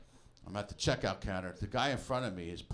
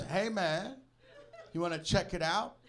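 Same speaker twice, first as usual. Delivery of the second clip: mailman voice; 8 semitones higher, 5 dB louder than usual. Retold or imitated. Mailman invites him in.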